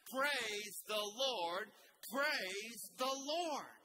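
slow, loud, rising declaration; passionate. Shown as a demonstration. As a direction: lead the room in a big slow praise. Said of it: "this last verse with me passionately".

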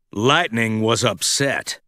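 usual speaking voice

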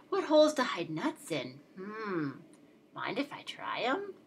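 ordinary delivery

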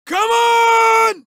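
loud. Acted. Game cop barks a take.

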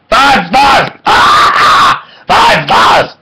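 overjoyed outburst; excited shout. Real, not acted. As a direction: yell it like a jubilant fan. Excited repeated rating shout.

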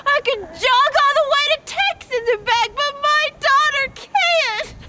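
desperate, wailing protest; anguished anger. Acted. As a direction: cry out at the unfairness. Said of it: "I'm so mad!".

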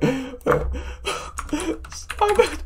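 a very high shriek calling his name; excited call. Real, not acted. Streamer shrieking for Simon, thrilled about the money. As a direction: yell for Simon across the house.

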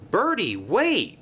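high, drawn-out call; crying out. A narrator's reading. Thomas calling after the departing bus, high cry. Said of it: "cried Thomas".

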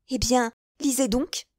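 a touch of triumphant pride; proud and joyful. A narrator's reading. Young wife proudly shows milady the letter, joyful. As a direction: hand it over beaming. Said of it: "dans l'excès de son orgueil et de sa joie".

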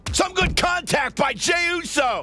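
excited call. Real, not acted. Big hit called.

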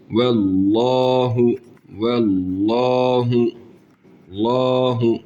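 Allahu with a full thick l, the a held one elif; thick recitation. Shown as a demonstration. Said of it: "Bak uzatıyoruz". (Allahu kalın ve bir elif uzatarak)

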